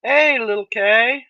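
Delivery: loud, slow, drawn-out hello; excited greeting. Real, not acted. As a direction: call out a happy welcome. Greeting a viewer joining stream.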